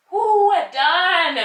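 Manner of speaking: high, loud, drawn-out cheer; a joyful slow whoop of relief; excited whoop. Real, not acted. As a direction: celebrate the end of the hold. Yoga teacher cheering end of hold.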